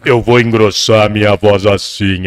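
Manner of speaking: a hint of a forced deep voice; disguised deep voice. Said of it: "ele fala assim".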